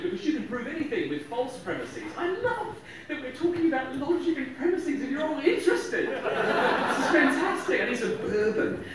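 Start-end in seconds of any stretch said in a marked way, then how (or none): 2.2-8.8 higher, laughing aside; a chuckling joke to the crowd; amused aside. Real, not acted. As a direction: tease the room while laughing. Joking aside to audience.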